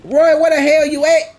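loud high yell for someone; calling out. Real, not acted. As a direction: call out across a distance. Calling out for Roy.

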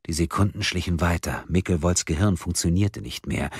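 ordinary delivery, nothing marked

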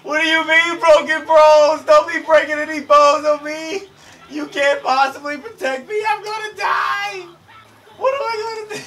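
high, loud mock-panic shrieking; panicked screaming. Injured fighter wailing he can't be protected, panicked high screaming. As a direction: shriek it like a hysterical coward. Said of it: "I've never seen someone so panicked".

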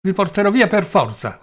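high, loud angry yell. Acted. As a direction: yell the threat at him. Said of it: "urlò Velchaninov".

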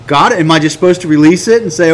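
ordinary talk

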